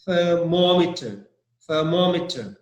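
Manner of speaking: "thermometer" stressed on the second syllable, MOM. Shown as a demonstration. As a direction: hit MOM in "thermometer", then fall away. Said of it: "I didn't put stress on ther".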